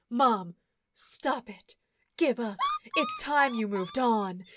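slow, lightly voiced stern little boy; mock solemn kid. Mimicking the film's son lecturing his mother, lightly voiced. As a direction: play a kid acting far too grown-up, slowly. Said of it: "They just show him being like".

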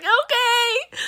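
high, drawn-out weepy okay; mock crying. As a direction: wail it in a comic tearful squeak. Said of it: "just fucking crying".

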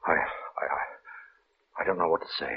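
words fail you, barely voiced; stunned whisper. Minister shaken after seeing the dead thing move, stunned whisper.

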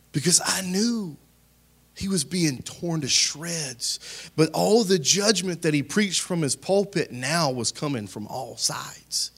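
usual speaking voice

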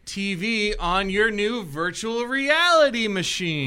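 loud, high, slow cheesy pitch; mock sales pitch. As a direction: sell it like a bad segue. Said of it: "Just kidding".

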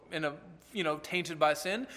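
ordinary talk, nothing marked

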